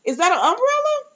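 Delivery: very high, incredulous squeal; baffled disbelief. Retold or imitated. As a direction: replay your shock way up high, drawing it out. Acting out own baffled reaction.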